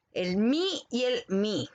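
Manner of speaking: slow el mi y el mi, set side by side; contrasting mi. Shown as a demonstration. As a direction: say both mi slowly to compare. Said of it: "por ejemplo". (los dos mi, lentos y comparados)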